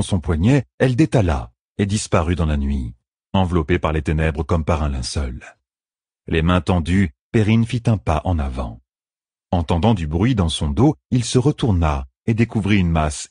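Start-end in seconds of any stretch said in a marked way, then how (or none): none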